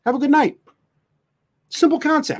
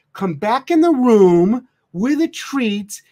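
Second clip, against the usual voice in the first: higher, very slow syrupy baby talk, mocking; mocking doting dog mom; 9 semitones higher, slower than usual. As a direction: ham up the owner cooing to her whining dog. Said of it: "They will literally say".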